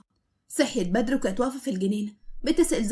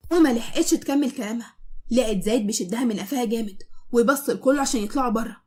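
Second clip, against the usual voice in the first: plain reading voice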